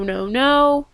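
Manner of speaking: normal talking voice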